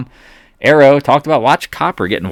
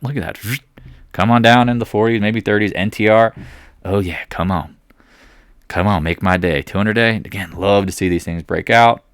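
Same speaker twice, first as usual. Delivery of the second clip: usual speaking voice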